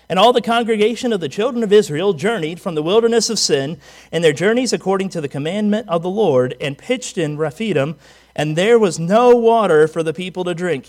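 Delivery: loud, declaiming scripture; scripture reading. Acted. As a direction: read the verse out to the congregation.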